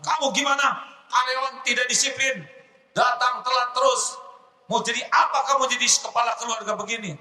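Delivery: high, fast angry scolding; an angry boss berating his late employee. Acted.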